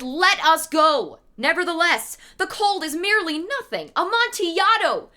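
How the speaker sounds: high, insistent, pushing on; eager Fortunato. Acted. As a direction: brush off the cold, eager to go.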